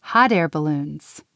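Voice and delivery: ordinary delivery, nothing marked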